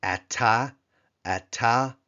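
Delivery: ata with stress on the last syllable, said twice; Hebrew ata. Shown as a demonstration. Teacher demonstrating ata to the class, clear and careful. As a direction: model the word ata cleanly for students. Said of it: "it is".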